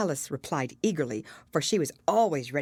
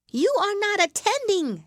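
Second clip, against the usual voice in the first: much higher, louder, stern mouse voice; severe mouse; 6 dB louder than usual. A narrator's reading. Mouse scolds Alice for not listening, stern high voice. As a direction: scold like a prim little mouse. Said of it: "said the mouse to Alice severely".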